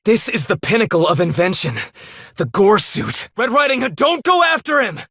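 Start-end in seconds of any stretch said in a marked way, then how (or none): 3.4-5.0 much higher, urgently calling after her. Acted. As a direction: shout to stop her from going.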